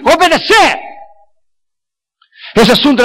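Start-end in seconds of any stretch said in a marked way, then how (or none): 0.0-0.8 "obedeced" punched high and ringing; stress on obedeced. Shown as a demonstration. Preacher driving home the one word, high and ringing. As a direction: land hard on "obedeced".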